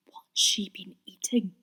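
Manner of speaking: whispered aside; muttered whisper. A narrator's reading. Percy muttering while straining at the rope, whispered. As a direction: mutter it under your breath. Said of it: "he muttered".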